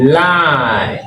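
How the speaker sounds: lie held long with an EYE vowel; long I pronunciation. Shown as a demonstration. Teacher models lie for learners, drawn-out long I. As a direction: draw out lie, gliding from AH to EE. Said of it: "on va dire I".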